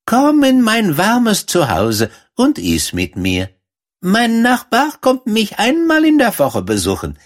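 a friendly busy mouse urging her guest; field mouse voice. Acted. Field mouse invites Thumbelina.

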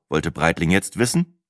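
ordinary delivery

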